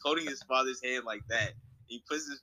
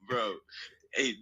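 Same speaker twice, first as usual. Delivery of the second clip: normal talking voice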